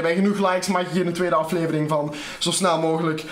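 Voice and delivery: usual speaking voice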